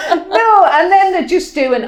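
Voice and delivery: high, laughing through the words. Real, not acted. Telling her friend about the scan, laughing.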